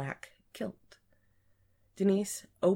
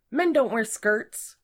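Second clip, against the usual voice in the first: high, loud, slow scolding; indignant, emphatic rebuke; 8 semitones higher, 6 dB louder than usual. A narrator's reading. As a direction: scold them, scandalized. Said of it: "as she scolded him".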